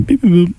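high string of beep-boop noises; imitated beep boop. Warning friends they'll catch his habit, high beep boop. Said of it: "you're gonna be doing".

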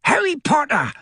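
very high, squeaky elf voice; high piping elf; high-pitched creature. A narrator's reading. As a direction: squeal it like an awed little creature. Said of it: "said the creature in a high-pitched voice".

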